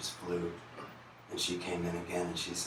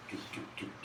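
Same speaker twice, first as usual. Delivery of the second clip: whispered call; 12 dB quieter than usual. Retold or imitated. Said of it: "she knocks again".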